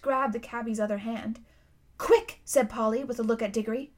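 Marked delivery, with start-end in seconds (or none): 2.0-2.4 sudden high-pitched prompt; urgent signal. A narrator's reading. Polly prompting Digory with a look, urgently. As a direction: urge a friend to act this instant.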